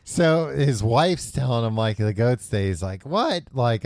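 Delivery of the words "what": high-pitched mock disbelief; a quick imitated "what?", pitched up; surprised retort. Husband hearing from his wife the goat is dead, taken aback. Retold shock at goat's death.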